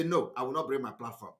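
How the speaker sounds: low, quiet, firm refusal; a flat no to the officials, low and quiet; calm refusal. Retold or imitated. Replaying his refusal to the officials in a low, quiet voice.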